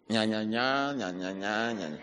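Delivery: low, soft mocking nya-nya babble; a taunting grumble; mock whining. Mocking complainers' whining noise.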